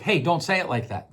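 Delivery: plain, even request falling at the end; matter-of-fact tone. Shown as a demonstration. Podcaster showing the same words in another tone, matter-of-fact correction. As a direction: say it as a simple, firm correction. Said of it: "Those same words, different tones".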